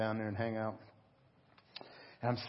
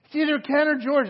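much higher and louder, giddy; high giggly girl voice; 12 dB louder than usual. Retold or imitated. Waitresses gossiping about the pastor, high and giddy. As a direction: play the excited waitresses, squealing.

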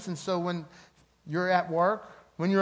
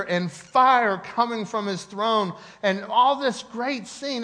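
preaching at full force; 6 semitones higher, 6 dB louder than usual. Acted. Preaching climax.

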